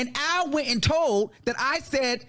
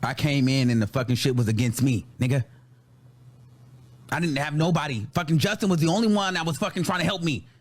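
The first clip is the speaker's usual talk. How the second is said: much lower-pitched, weary venting; low and dejected; 9 semitones lower than usual. Real, not acted. Recalling having nobody on their side, low and dejected. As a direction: sink into a low, hurt register. Worn out, recalling being alone.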